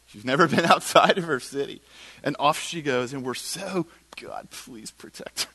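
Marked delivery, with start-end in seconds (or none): 4.2-5.5 slow, hushed, near-whispered prayer; pleading prayer. Retold or imitated. Sending a teenager off alone, hushed pleading prayer. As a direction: plead softly under the breath.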